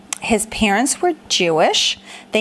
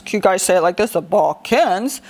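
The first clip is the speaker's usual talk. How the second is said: funny low voice; 4 semitones lower than usual. Shown as a demonstration. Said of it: "so let's say it like this".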